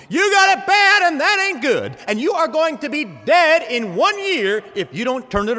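a doctor yelling the verdict to wake you up; blunt doctor shouting. Pretend. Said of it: "the doctor has to kinda turn up the temperature".

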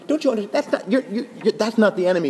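high, stumbling, incredulous protest; exasperated correction. Acted. Preacher correcting people fighting each other, exasperated. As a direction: protest as if they have missed the point.

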